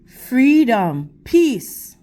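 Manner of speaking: steady, expressive storybook reading to a class; reading to children. Acted. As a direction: read the picture book to the kids, clear and measured. Teacher reads picture book aloud.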